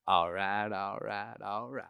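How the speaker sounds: low, laid-back Texas drawl; Matthew McConaughey. Shown as a demonstration. Showing the party trick, low easy drawl impression. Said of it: "all you're doing is going".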